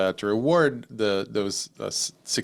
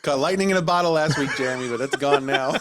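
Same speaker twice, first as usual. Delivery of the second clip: laughing; 4 semitones higher than usual. Real, not acted. Joking at Jeremy's expense.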